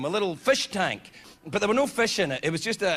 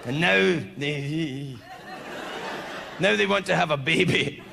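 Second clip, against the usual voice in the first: a hint of a bitter old teacher, lower; lightly voiced nasty teacher lecturing slowly; science teacher; 4 semitones lower, slower than usual. Teacher presenting toads to the class, mildly gruff.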